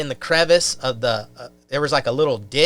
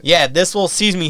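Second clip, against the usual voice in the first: ordinary talk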